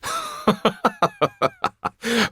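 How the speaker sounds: high, ringing mocking laugh; loud scornful laugh. Marquis laughing off the Count's claim, loud mocking laugh. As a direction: burst out laughing at him. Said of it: "he laughed out loud".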